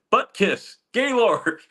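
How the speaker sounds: high-pitched cartoon juvenile name-calling; loud, high put-on taunt; Beavis and Butthead. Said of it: "like that Beavis and Butthead".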